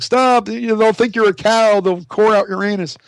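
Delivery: Jeff yelling after him, high panicked warning; panicked friend. Retold or imitated. Jeff yelling after him in the desert, high panicked warning. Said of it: "And Jeff is like".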